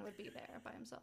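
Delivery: hushed gossipy whisper; a conspiratorial whisper to her co-host. Real, not acted. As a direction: let it slip out breathily as a secret. Gossipy aside, unvoiced.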